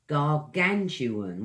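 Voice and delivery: Gargantuan slow, every syllable clear; slow careful pronunciation. Shown as a demonstration. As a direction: spell out Gargantuan by sound. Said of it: "Use your tongue a lot in this one".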